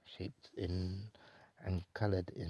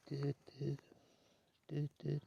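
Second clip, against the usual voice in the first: sung high ringing tone, higher; hummed dial tone, higher; phone ring imitation; 7 semitones higher than usual.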